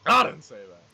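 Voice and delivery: loud, indignant mock denial; mock protest. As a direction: play along, protesting loudly. Player echoes GM mockingly.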